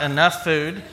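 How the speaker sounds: usual speaking voice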